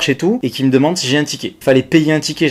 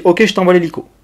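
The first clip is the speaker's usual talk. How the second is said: higher mock operator voice; imitating the operator, higher; operator's offer; 7 semitones higher, 4 dB louder than usual. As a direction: promise help briskly like a call handler. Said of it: "Et donc elle me dit".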